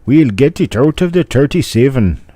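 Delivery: high, loud, hammed-up Irish accent; horrible Irish accent. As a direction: belt it out in a bad stage-Irish accent. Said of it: "It's a horrible Irish accent".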